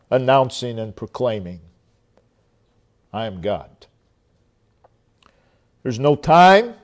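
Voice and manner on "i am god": hushed voice of God. Acted. Preacher voices God's proclamation.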